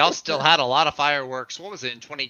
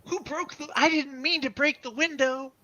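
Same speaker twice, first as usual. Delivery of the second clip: put-on much higher kid voice, pleading; squeaky boyish innocence, pitched way up; Beaver Cleaver voice. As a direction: play a guilty little boy from an old sitcom. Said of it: "Say, "Jeepers, Wally".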